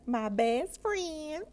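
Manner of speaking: high, slow, drawn-out singsong; gleeful declaration. Acted. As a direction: stretch it out with playful glee. Gleefully declares clerk her friend.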